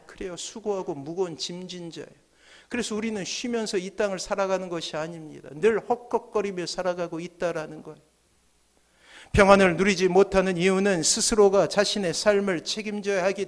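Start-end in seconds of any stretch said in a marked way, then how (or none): none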